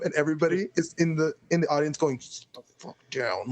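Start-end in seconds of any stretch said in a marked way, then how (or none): 2.2-3.5 low-volume mock telling-off; retold hushed scolding. Wedding audience to the friend at the podium, hushed scolding. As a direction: imitate an urgent hushed order.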